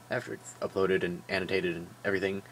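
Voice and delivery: ordinary talk, nothing marked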